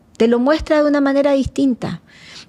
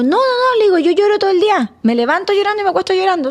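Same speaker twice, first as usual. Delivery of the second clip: insistent protest; 7 semitones higher, faster than usual. Retold or imitated. Insisting to her therapist.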